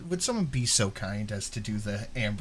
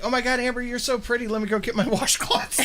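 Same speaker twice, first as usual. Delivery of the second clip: gushing fan; 11 semitones higher, faster than usual. Retold or imitated. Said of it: "every voice note she gets is like".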